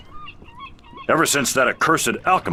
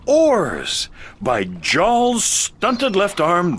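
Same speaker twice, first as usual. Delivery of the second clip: sarcastic; slower than usual. Acted. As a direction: fake delight at an obvious idea. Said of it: "Are you being sarcastic?".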